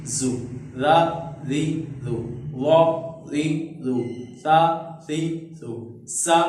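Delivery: short syllables ता, ती, तु sounded one at a time with pauses; teacher demo recitation. Shown as a demonstration. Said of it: "मैं एक दफ़ा पढ़ के आपको सुनाता हूँ".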